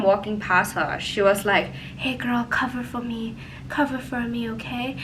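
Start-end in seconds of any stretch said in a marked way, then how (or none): 2.0-4.9 put-on drunk roommate pleading, much softer; imitating drunk roommate. Drunk roommate begs narrator to cover for her, soft pleading. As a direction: hush it like a drunk friend begging a favour.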